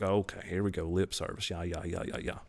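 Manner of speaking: low, soft, tuned-out muttering; dismissive brush-off. Retold or imitated. Husband ignoring coworker's church invitation, low dismissive mumble. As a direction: let it trail down into bored repetition.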